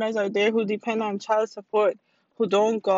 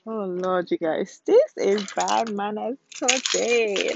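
usual speaking voice

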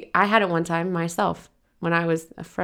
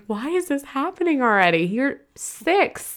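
anguished; 8 semitones higher than usual. Retold or imitated. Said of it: "And I was like".

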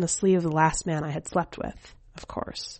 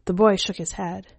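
plain reading voice

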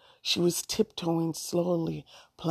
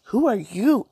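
grimacing question; 5 semitones higher, 8 dB louder than usual. Acted. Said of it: "Allison said with grimace".

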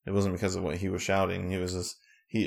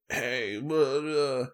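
higher, babbling mimicry; mock bully; 6 semitones higher, much slower than usual. Retelling the bully's taunts to co-host, mock bully.

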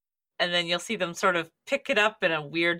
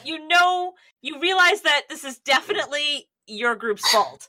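dramatic realization; 7 semitones higher than usual. A narrator's reading.

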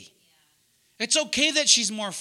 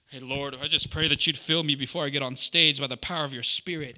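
a lower, lightly put-on prayer voice; pious prayer; 7 semitones lower than usual. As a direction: pray as a smug churchgoer.

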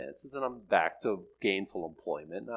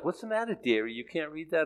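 high mock old lady voice; 6 semitones higher, 4 dB quieter than usual. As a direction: tease like a sweet old granny. Old lady quoted in dream.

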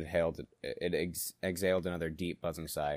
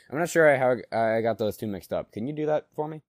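flustered cover-up; 8 semitones higher, 9 dB louder than usual. Acted. Player flustered, covering slip.

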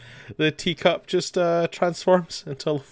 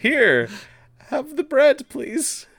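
much higher, pleading little voice; mock eager offering; 12 semitones higher, 3 dB louder, much slower than usual. Jesus pressing bread on the landlady, mock flustered. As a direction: imitate a flustered, polite offer, pitched up.

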